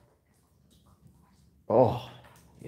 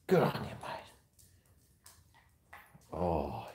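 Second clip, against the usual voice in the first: ordinary delivery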